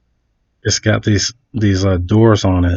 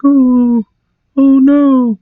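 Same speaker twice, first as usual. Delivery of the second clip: high, loud, drawn-out fake dismay; mock damsel in distress; 14 semitones higher, 5 dB louder, much slower than usual. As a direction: play the helpless damsel, hammed-up. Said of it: "damsel in distress".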